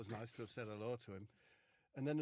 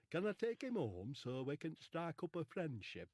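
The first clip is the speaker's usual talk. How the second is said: higher Yorkshire-ish voice; Charlie Williams voice; 4 semitones higher than usual. Retold or imitated. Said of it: "Phil Jones talks like that".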